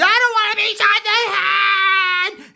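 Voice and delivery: very high, loud, rapid wail; panicked scream. Acted. Fleeing man dreading a bullet, high panicked scream. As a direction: scream as you run for your life.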